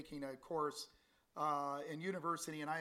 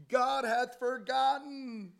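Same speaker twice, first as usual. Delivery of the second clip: full-force preaching; 7 semitones higher, 8 dB louder than usual. Acted. Preacher thundering the wicked man's words at the congregation.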